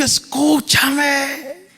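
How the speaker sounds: high, drawn-out call; crying out. Acted. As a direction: call out slowly like Wisdom pleading to be heard. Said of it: "la sabiduría les ha gritado". (clamor agudo y alargado)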